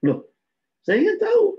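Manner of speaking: sudden high, startled curiosity; surprised question. Retold or imitated. Blind man startled by his guide, surprised. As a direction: blurt it out in disbelief.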